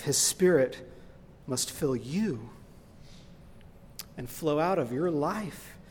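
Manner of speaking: ordinary talk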